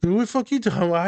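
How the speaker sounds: high, fast, baffled client; retold client protest. Client confused by the therapist's joke, high and fast. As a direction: squawk it out confused and quick.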